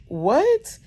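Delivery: sharp high shocked "What?". Retold or imitated. Mom hearing the cord news, shocked high yelp. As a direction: jolt up high in alarm.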